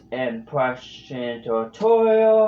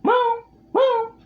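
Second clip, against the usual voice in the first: very high louder snail meow; Gary meow; 5 dB louder, much slower than usual. Shown as a demonstration. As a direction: squeeze out a tiny high meow. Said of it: "Gary, meow".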